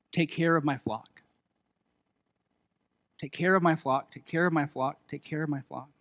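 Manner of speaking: soft, low, hushed words of Jesus; growing hushed as if spoken close; quiet tender repetition. Acted. Jesus's quiet charge to Peter.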